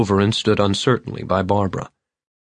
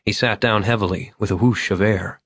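usual speaking voice

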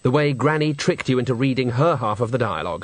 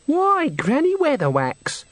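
put-on much higher, slow and bright greeting of a girl; happy young woman; slower than usual. A narrator's reading. Girl at the brothel door welcoming Granny, high and happy. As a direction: greet her like a delighted young hostess. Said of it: "said the girl happily".

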